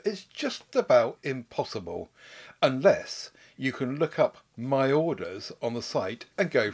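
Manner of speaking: loud, formal complaint letter read. Acted. Reading a listener's complaint letter.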